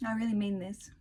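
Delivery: ordinary talk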